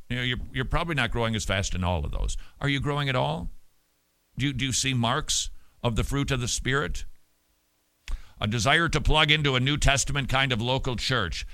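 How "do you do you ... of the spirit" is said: ordinary talk, nothing marked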